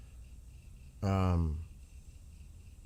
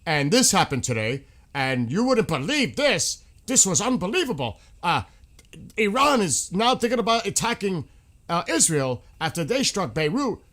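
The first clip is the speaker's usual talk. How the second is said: over-the-top newsreader voice, pitched way up; mock dramatic news anchor; 10 semitones higher, 7 dB louder than usual. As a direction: ham up a breathless TV presenter selling a story. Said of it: "they're using their voice".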